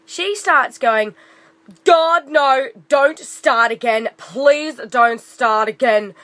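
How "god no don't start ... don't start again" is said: loud, slow, exasperated God no, dragging the please; whiny loud complaint. Retold or imitated. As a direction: moan at the latecomer to not restart. Rude customer complaining loudly.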